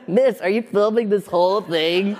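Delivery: high, amused quoting voice; lightly voiced bystander asking; mock polite complaint. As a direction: toss it off as a bemused onlooker. Quoting someone on set, laughing.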